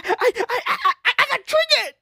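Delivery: high, fast, stammering mock voice; mock triggered whine. Parodying Gen Z getting triggered, in a high, fast, stammering whine. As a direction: rattle it off up high like a hysterical kid.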